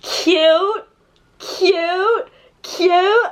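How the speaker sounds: high, gushing cute-overload squeal; mock gushing. Vlogger mocking her fake delight over a mangy dog, gushing. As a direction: coo over the dog like it's adorable. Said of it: "And I'm like".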